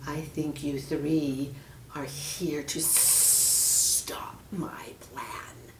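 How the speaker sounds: slow, whispered sinister hiss; menacing snake hiss. Acted. Snake squeezes the children, hissing threat. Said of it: "hissed the snake".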